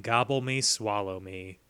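low, hushed closing line of verse; mock-solemn recital. Host ends the patron's poem, deadpan and hushed. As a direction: drop low and soft to finish the poem. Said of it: "only way that I can end this".

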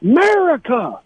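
high-pitched mock yelling of America. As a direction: call it out high like a passing heckler. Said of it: "they start yelling".

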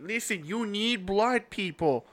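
hammed-up exasperated Chicago street-corner guy; Chicago accent. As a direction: plead slowly in a thick Chicago accent. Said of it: "like, this big guy from Chicago".

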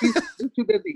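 high, far-off mocking parent voice; mocking a mom who insists her son is just too busy, high put-on voice. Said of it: "it's that denial of".

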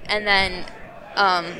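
ordinary talk, nothing marked

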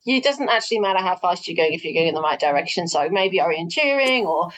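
usual speaking voice

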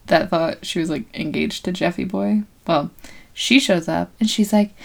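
ordinary talk, nothing marked

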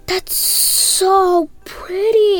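slow, drawn-out wonder; admiring. Real, not acted. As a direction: linger on how pretty it is. Reader admiring picture.

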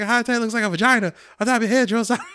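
hammed-up recruit mouthing off; cheeky recruit. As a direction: blurt out something stupid to the sergeant. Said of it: "and I think I even said".

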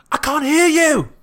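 high, slow, drawn-out chef shout; Gordon Ramsay. Retold or imitated. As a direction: goad them loudly, as a TV chef impression. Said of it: "Gordon Ramsay's trying very hard".

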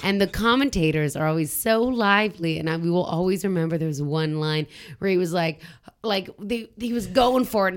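ordinary delivery

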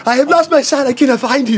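high, anxious little puppet voice; a small father pleading for his lost son; lightly voiced high worried dad; worried toy father. Mister Jumbie searching for his son.

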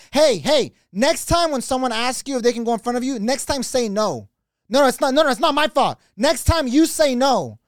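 high, loud telling-off; hammed-up defensive scolding; scolding customer. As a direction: imitate an indignant lady shifting the blame. Acts out lady scolding them.

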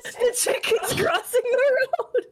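high, slow, laughing-crying squeal; laughing disbelief. Retold or imitated. Retelling seeing a chicken in Fortnite, laughing hard. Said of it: "I'm like".

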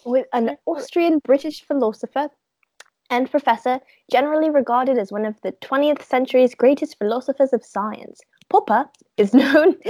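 light put-on British accent, formal and a little hammed-up. Introducing philosopher Popper to her friend in a British accent. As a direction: read the bio in a mock British accent. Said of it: "Such a good British accent".

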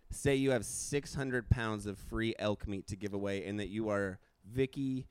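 normal talking voice

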